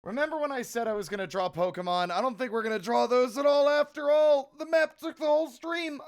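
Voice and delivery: loud, high, bursting with energy; excited. Real, not acted. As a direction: blurt it out all fired up. Excited streamer announcing plan change.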